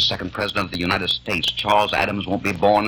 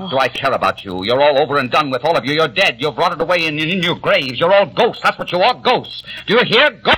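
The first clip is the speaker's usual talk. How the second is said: building from angry to screaming, far higher and louder; hysterical shout; 8 semitones higher, 3 dB louder than usual. Acted. Peter screams at the family that they are all ghosts, building to a shriek. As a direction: scream it at them like a madman.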